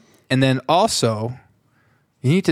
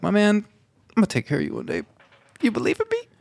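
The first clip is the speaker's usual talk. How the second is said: higher voice acting out a pledge; acted-out promise; 8 semitones higher, faster than usual. Retelling a promise to take care of a teammate, acted-out promise. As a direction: play a mock-earnest promise.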